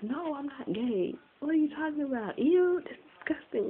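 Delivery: high-pitched, mock-disgusted protest; indignant denial. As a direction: deny it in a high, exaggerated voice. Retold denial to questioning father.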